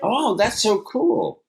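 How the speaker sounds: high, slow and gushing; delighted amazement. Real, not acted. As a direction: savor it, drawn out in delight. Reacting with amazement.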